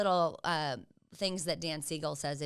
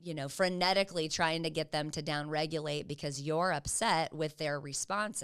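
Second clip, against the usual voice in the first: usual speaking voice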